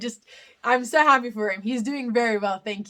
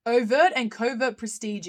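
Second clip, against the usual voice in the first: ordinary delivery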